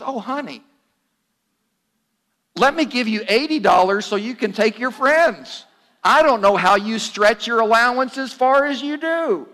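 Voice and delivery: put-on sweet mom offering her teen money; gushing mom. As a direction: pour it on like a mother charmed by her kid. Delighted mom rewards good teen.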